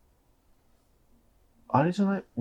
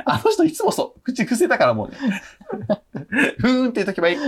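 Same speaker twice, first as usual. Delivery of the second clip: higher mock mom voice; gossiping mother; 10 semitones higher than usual. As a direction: keep the mom's gossip going. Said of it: "っつって". (母の口まねを高めに)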